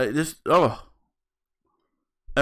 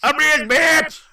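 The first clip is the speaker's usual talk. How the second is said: high, drawn-out bragging cry; high-pitched comic gloating; mock boast; 11 semitones higher, slower than usual. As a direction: crow it like a show-off, stretched out. Mock rich man's boast.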